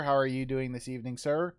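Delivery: ordinary delivery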